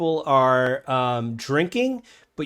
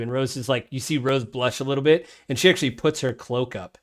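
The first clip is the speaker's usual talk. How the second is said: ordinary talk, nothing marked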